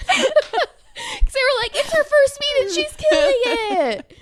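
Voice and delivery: high, slow, laughing delivery. Real, not acted. Retelling what she thought the cheers meant, laughing. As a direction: laugh through the memory.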